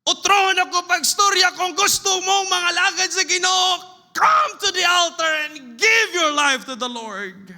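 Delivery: high, loud shout preaching; shouted altar call. Retold or imitated. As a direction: cry out the invitation at full force. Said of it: "nagwali siya".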